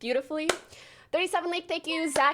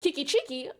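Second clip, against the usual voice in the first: ordinary talk, nothing marked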